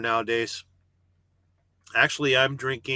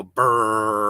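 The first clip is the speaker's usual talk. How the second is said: reefer hum; 3 semitones lower, 4 dB louder than usual. Retold or imitated. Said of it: "you might hear the reefer go".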